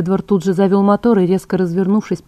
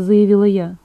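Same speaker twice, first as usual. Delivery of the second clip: plain reading voice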